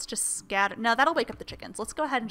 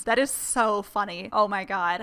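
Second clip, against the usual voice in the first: ordinary talk, nothing marked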